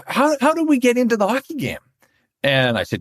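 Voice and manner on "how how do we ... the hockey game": lightly voiced tourist. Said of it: "I'm not gonna do the French accent".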